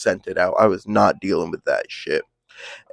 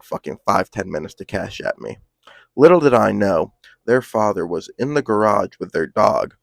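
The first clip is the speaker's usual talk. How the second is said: ordinary delivery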